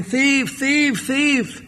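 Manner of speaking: high cry at held-back volume; mock shouting. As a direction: imitate a householder calling for help. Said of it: "The householder was shouting".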